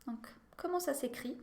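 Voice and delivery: "comment ça s'écrit" whispered, soft and breathy; whispered repeat. Shown as a demonstration. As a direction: whisper the phrase like a secret. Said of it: "Répétez". (sussurrando a frase para a turma repetir)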